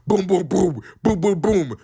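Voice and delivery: loud booming knocks on a door, voiced; imitated door bangs. Retelling the security banging on the stall door, booming knocks. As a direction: pound out each boom like a fist on the door. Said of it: "we hear fucking three bangs".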